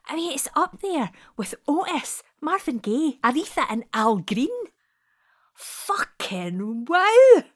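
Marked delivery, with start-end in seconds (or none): 5.5-7.4 much louder, slow, amazed burst; awed exclamation. Acted. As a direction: let the wonder explode out. Awed by the dream song.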